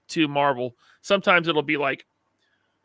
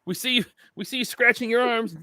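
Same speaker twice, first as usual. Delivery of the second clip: lightly put-on high voice; mock caller; 8 semitones higher than usual. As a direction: join in as the teasing caller. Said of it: "You get a phone call".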